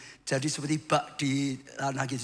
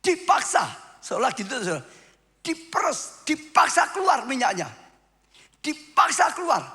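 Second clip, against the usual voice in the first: high, loud pulpit preaching, hammering each word; fervent preaching; 9 semitones higher, 5 dB louder than usual. Acted. Preacher urging the congregation on, loud and high. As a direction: drive the point home to the congregation.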